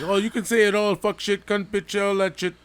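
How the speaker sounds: high, loud hammed-up Aussie that drifts Jamaican; Aussie voice slipping into Jamaican; mock Australian accent. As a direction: play a cartoon Australian swearing freely. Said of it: "in Jamaica there for a second".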